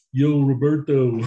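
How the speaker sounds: laughing slow Rocky call; loud Rocky yell through laughter; Rocky impression. Repeats Rocky catchphrase laughing.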